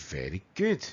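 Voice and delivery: ordinary talk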